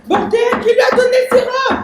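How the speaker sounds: high-pitched furious demand; angry yelling. Acted. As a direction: yell it as an exasperated accusation. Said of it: "arrêtez de crier".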